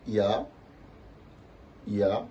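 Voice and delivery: ya rising at the end; second tone. Shown as a demonstration. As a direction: lift "ya" upward. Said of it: "ça va faire quoi".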